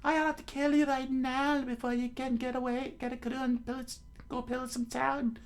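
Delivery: high furious character shout; Nami yelling. Acted. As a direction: threaten him furiously. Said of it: "Nami yelled at him".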